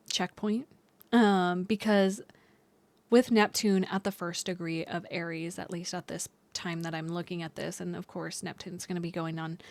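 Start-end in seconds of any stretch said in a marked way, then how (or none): none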